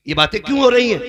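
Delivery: high, booming accusing question; loud questioning. Acted. Father-in-law questions widowed Amina, loud questioning. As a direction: demand an answer at full voice.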